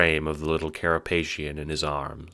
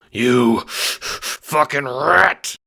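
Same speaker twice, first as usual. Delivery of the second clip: slow, loud, higher furious roar; bellowing bookie; 8 semitones higher, 6 dB louder, much slower than usual. Acted. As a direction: bellow it at the rat. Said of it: "the burly bookie bellows".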